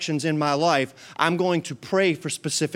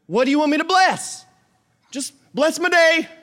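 high, loud put-on voice; hammed-up back and forth, high and loud; mock exchange with God; 12 semitones higher, 5 dB louder than usual. Pastor plays God and the believer, high and loud. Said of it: "God's like".

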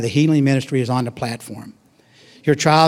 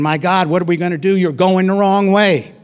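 mild mock scream; 5 semitones higher, 3 dB louder than usual. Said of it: "Your wife starts screaming".

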